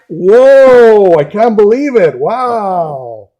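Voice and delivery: loud, hammed-up astonishment, easing off; mock amazement. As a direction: pretend to be blown away by the reveal. Pretend unveiling of the top album.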